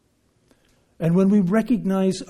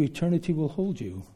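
ordinary talk, nothing marked

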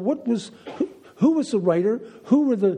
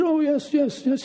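high-pitched imitation of an old priest; elderly Jesuit priest; 8 semitones higher than usual. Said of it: "He was ninety-four at the time".

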